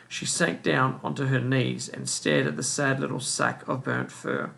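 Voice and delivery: usual speaking voice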